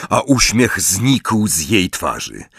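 ordinary delivery, nothing marked

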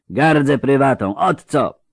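lightly voiced grating indignation; a testy character's retort; grating, indignant. A narrator's reading. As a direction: bristle with mild indignation. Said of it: "zaperzył się zgrzytliwy".

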